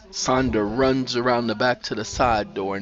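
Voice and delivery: ordinary delivery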